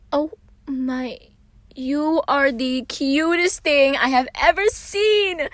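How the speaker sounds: high, loud, slow squeal of delight. A narrator's reading. Patton gushing over Roman before hugging him, high squeal. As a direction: gush over something adorable. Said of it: "Patton squealed".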